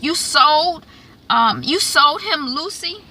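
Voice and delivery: high, appalled question to her mother; lightly voiced outraged daughter; mock disbelief; shocked accusing. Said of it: "and she's like".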